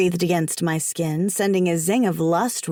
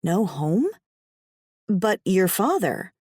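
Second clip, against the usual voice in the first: usual speaking voice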